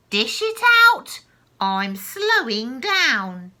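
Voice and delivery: crone barking orders; yelling. Acted. Grandma yelling at George to hurry the dose. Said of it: "she yelled".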